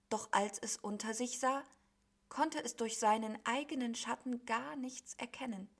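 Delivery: ordinary delivery, nothing marked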